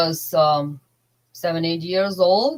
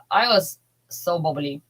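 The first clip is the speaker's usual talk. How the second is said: usual speaking voice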